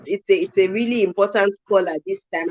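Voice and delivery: normal talking voice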